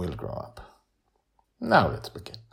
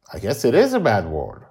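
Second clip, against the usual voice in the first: put-on child's mildly surprised realisation, raised voice; earnest surprise; 5 semitones higher, 7 dB louder than usual. A narrator's reading. Girl admits to grandma the word is bad, earnestly surprised. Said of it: "with earnest surprise".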